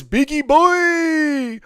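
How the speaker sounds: high, slow, drawn-out mock call; reading a viewer's chat line in a silly stretched voice; mock hype shout. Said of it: "Jamie says".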